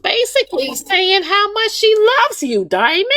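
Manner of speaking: very high, squealing mock outrage; mock outraged listener. Speaker mimics listeners defending the elder lady, squealing high mock outrage. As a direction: shriek it like a scandalised fan. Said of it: "the first thing y'all will say is".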